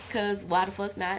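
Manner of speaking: usual speaking voice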